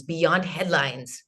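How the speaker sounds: beyond headlines, slow, with beyond leaned on; slow weight on beyond before headlines; stress on beyond. Shown as a demonstration. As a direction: draw out beyond to correct the word headlines. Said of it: "That's the accurate translation".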